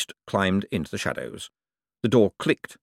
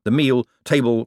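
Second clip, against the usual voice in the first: ordinary delivery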